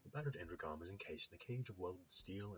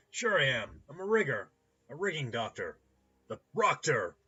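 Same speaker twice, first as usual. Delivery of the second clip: high, loud, showman's boast; boisterous clinic owner; 8 semitones higher, 14 dB louder than usual. Acted. Rigging doctor introducing his title to a customer, boisterous. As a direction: announce the title with big gusto. Ambrose proudly names his trade.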